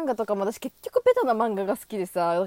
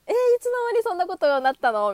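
high, loud and fast, mock astonished; acting out a friend's startled outburst; surprised gasp; 9 semitones higher, 6 dB louder, faster than usual. Imitating a friend's shocked reply to her news, high and quick.

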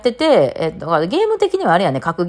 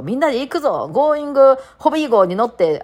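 imitated rallying call; 8 semitones higher than usual. Acting out a captain rallying followers.

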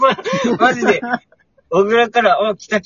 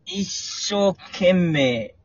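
very slow recitation; 4 dB quieter, much slower than usual. Acted. Reciting very slowly.

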